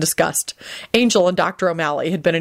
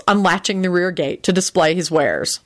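ordinary delivery

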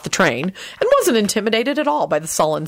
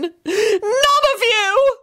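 high, drawn-out wailing bellow; outraged high wail; much slower than usual. A narrator's reading. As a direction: bellow it in hysterical fury. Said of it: "Lady Fanshawe bellowed".

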